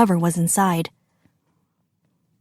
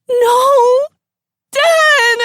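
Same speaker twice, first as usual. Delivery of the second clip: very high, loud wail; anguished cry; 14 semitones higher, 6 dB louder than usual. A narrator's reading. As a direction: cry out for dad in despair. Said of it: "Akira cried again".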